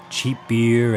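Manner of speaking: usual speaking voice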